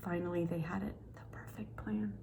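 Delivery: secretive hushed whisper. Acted. Squirrels hatching their secret plan, whispered. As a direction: breathe it like a secret.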